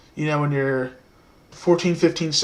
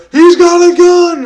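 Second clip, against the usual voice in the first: much louder, higher, slow yelled warning; louder high shout; 12 semitones higher, 9 dB louder, slower than usual. Retold or imitated. Teen yelling the fake warning again, louder and higher. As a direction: belt it out louder this time. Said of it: "And I say it louder".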